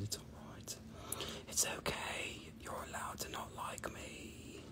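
breathy teasing whisper, barely voiced; mock soothing whisper. Host teasing triggered commenters, a mock-soothing whisper. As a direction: hush them like a fussy baby, tongue in cheek.

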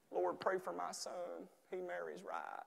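soft, slow Southern prayer voice; southern-accent prayer. Acted. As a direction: pray gently in a drawn-out Southern accent. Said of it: "I use a southern accent".

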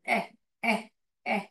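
"एह" said as a short open eh, repeated; eh sound. Shown as a demonstration. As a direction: drill the "एह" sound for students. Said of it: "यहाँ पे एह sound करता है".

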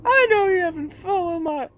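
very high put-on voice; high character voice. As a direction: accuse in a squeaky cartoon voice. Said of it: "Bitch is like".

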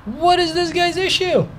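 high-pitched, incredulous complaint; exasperated outburst. Real, not acted. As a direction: squeal it in disbelief at another driver. Game driver blocks the bus.

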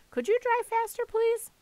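high, urgent plea; high-voiced nervous politeness; anxious request. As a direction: urge the driver on nervously. Grover nervously urging the driver.

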